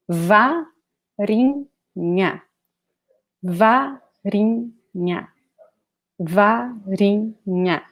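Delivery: "varinha" drawn out slowly, nh like ny; slow drill of nh. Shown as a demonstration. Teacher slowly drilling the nh in "varinha". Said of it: "let's practice a little bit".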